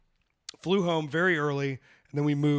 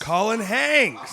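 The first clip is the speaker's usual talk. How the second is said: dramatic realization; 7 semitones higher, 8 dB louder, much slower than usual. Real, not acted. Dramatic slow repeat of name.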